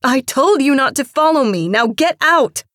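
very loud, high furious order; angry scream. A narrator's reading. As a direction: scream to drive someone out. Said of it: "Marcia screamed".

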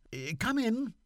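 lightly voiced young gentleman puzzled; Seaforth's voice. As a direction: fret aloud as the lieutenant.

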